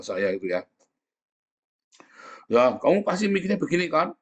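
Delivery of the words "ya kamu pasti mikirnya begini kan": mock accuser. Mocking an accusing person.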